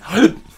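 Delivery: sharp high gasp of sudden realization; mock gasp. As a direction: gasp as if it just dawned on you. Sudden realization mid-argument.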